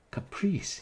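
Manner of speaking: high outraged exclamation; indignant cry. Acted. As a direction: shoot up in disbelief. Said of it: "he cried".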